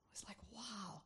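hushed breathy wow; awed whisper. Real, not acted. Preacher whispers her awe at the prophecy. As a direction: let the wonder fall to a whisper.

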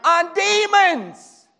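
loud, high, drawn-out exclamation; fired-up preaching. Real, not acted. Preacher to congregation on the possessed man, fired-up shout. Preacher at full force describing madman.

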